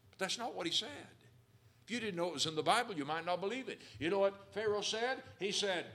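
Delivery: loud, fervent preacher voice; preaching at full force. Acted. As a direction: preach it out to the congregation. Preaching to congregation.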